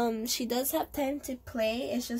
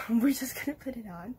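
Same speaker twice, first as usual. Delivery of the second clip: whispering. Real, not acted. Whispering with a laugh.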